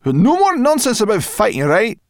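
high, loud, outraged scolding; indignant brownie girl. Acted. Brownie girl scolding the dragon over his fighting plan. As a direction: tell him off. Sorrel insists.

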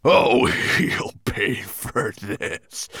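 slow, pained growl of vengeance; wounded dragon vowing revenge; angry bellow. A narrator's reading. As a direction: drag it out, seething. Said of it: "bellowed Nettlebrand".

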